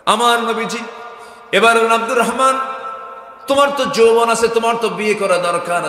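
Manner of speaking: loud, high-pitched sermon cry, easing at the end; full-force preaching. Real, not acted. Preacher promising forgiveness of sins, impassioned loud preaching. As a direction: proclaim it at the top of your voice.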